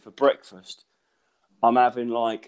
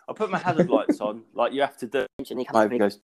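ordinary talk

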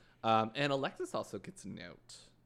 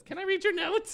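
much higher and louder eager plea; imitating Juju; 9 dB louder than usual. Juju begging to read the note, high mock plea. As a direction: beg brightly in a high mock voice. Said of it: "she goes and says".

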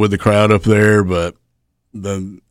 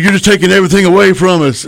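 high, fast, indignant yell; angry complaint; 9 semitones higher, 4 dB louder, faster than usual. Retold or imitated. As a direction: rattle off the grievance in a high yell. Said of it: "He goes".

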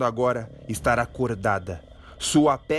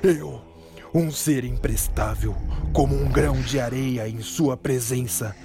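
lightly voiced begging hero; humble pleading. Troy pleading to the monster.

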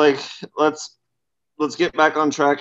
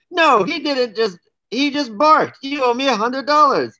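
high-pitched, hammed-up indignant retort; lightly acted furious comeback; mock fury; 9 semitones higher than usual. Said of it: "replies back furiously".

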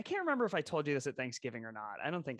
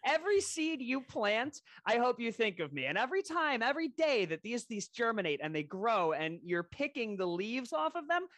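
higher, needling guilt trip to a friend; 6 semitones higher than usual. Retold or imitated. Friend mock-guilts a friend over a gifted herb garden, needling. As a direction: pile on the guilt, mock-wounded.

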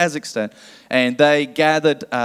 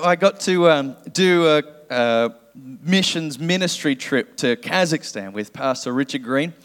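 ordinary talk

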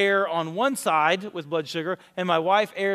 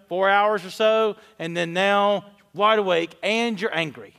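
ordinary delivery

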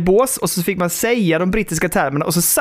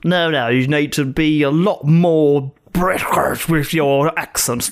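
slow, lightly posh English; light British accent; mock British teacher; 3 semitones lower, slower than usual. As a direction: imitate a Lund lecturer scolding. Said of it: "bara typ så här".

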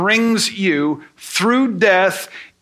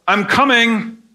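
a light mock call upstairs, a bit higher; mild retold yell; 4 semitones higher than usual. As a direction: call out to the wife downstairs, only half-shouted. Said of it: "and I yell".

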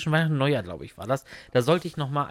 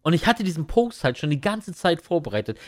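ordinary delivery

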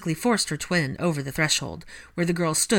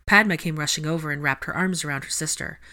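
ordinary delivery

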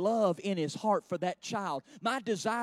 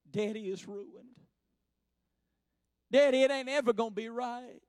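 mock crying child; 4 semitones higher than usual. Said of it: "She got tears running down her eyes".

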